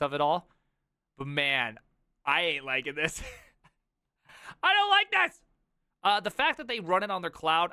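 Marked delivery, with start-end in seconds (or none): 4.6-5.4 laughing. Real, not acted. Reacting to Microsoft money grab.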